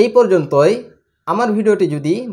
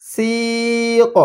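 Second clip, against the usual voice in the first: very slow blend; 3 semitones higher, much slower than usual. Shown as a demonstration. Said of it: "টানতে হচ্ছে".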